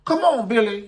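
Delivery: high, loud mock of a pushy man; hammed-up high voice of a creep ordering Billy into the car. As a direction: bark a high-pitched order to get in. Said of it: "And so he went".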